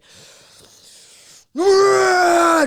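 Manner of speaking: long raw scream of pent-up anger and grief; anguished scream. Real, not acted. Told to express it with a sound, he screams. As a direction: let it all out in one howl. Primal scream in therapy circle.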